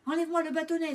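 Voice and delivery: excited cry. A narrator's reading. Doudou sure his tail has curled, crying out excitedly. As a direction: call out eagerly to big brother. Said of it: "s'écria-t-il".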